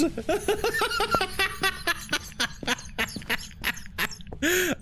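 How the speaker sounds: long high fit of laughter; cracking up completely. Real, not acted. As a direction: laugh helplessly, high and drawn out. Cracks up at mispronunciation.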